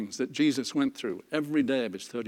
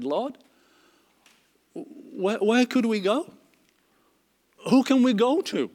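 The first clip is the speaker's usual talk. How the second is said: earnest plea; 8 semitones higher, 4 dB louder than usual. Acted. Peter pleads with Jesus.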